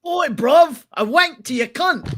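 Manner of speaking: very high, loud British yob caricature; mock British accent. Host proving his accent to a doubter, mock British accent. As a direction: bray it in exaggerated British slang accent. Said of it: "you definitely sounded Australian there".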